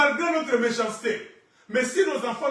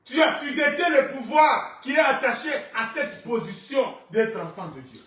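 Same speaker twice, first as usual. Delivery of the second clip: raised, ringing declaration to the congregation; forceful preaching; 5 semitones higher, 6 dB louder than usual. Real, not acted. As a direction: proclaim it loudly, stretching key words.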